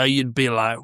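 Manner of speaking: usual speaking voice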